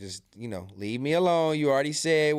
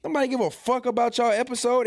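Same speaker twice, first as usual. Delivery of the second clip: indignant outburst; 9 semitones higher than usual. Real, not acted. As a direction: blow up in disbelief.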